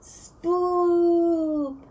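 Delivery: a hammed-up little ghost boo; fake spooky ghost noise. [name] teasing the group with a mock spooky call. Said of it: "doing fake spoop impressions".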